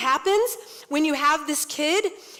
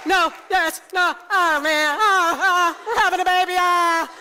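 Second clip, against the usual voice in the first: louder, mock-flustered husband; 4 semitones higher, 4 dB louder than usual. Husband caving in about another baby, mock-flustered. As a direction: stammer like he can't decide, half-hearted. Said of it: "and he's like".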